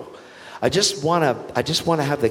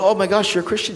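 high-pitched amazed exclamation; quick, high burst of surprise; retold surprise; 9 semitones higher, faster than usual. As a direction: re-enact a delighted discovery.

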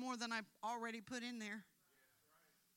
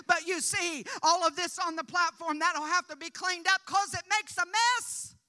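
loud, high climactic preaching; preaching at full force; 9 semitones higher, 15 dB louder than usual. Acted. Preacher proclaiming Jesus cleans the heart, at full shout.

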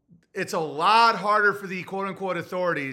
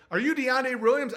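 higher, pointed officer question; lightly voiced cop; 6 semitones higher than usual. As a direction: question the suspect by name. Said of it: "It's like".

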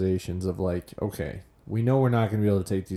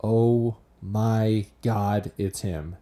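stunned realization; much slower than usual. Retold or imitated. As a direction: stretch each word in hushed recognition. Retelling stunned moment of recognition.

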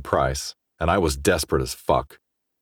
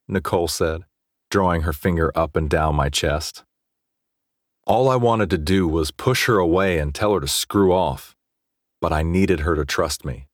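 usual speaking voice

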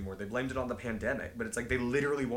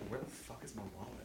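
muttering; 3 semitones lower, 16 dB quieter than usual. Real, not acted. Suddenly looking for his wallet.

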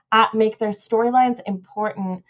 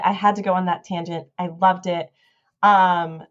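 ordinary talk

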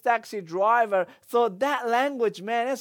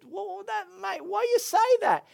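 incredulous; 11 semitones higher than usual. Retold or imitated. Retelling disbelief at taxi driver.

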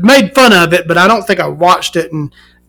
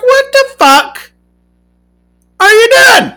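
much higher, loud disbelief; incredulous rant, pitched way up; exasperated outburst; 3 dB louder than usual. Real, not acted. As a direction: yell it up high in disbelief. Ranting in disbelief.

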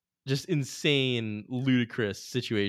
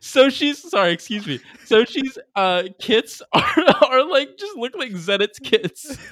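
high, loud, incredulous exclamation; shocked outburst; 12 semitones higher, 9 dB louder than usual. Real, not acted. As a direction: blurt it out in disbelief. Spots the kits, shocked.

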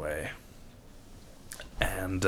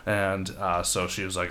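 normal talking voice

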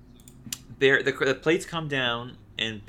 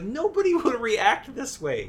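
high, slow, incredulous with a laugh; exasperated laugh at the game; laughing disbelief; 12 semitones higher, 4 dB louder than usual. Retold or imitated. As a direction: scoff it out laughing. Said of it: "I was like".

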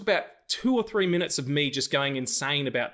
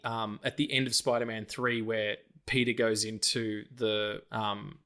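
normal talking voice

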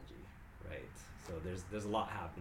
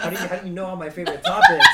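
loud, high, laughing disbelief; excited laugh; 11 semitones higher, 24 dB louder, faster than usual. Real, not acted. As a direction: burst out laughing with delight. Guest delighted at topics.

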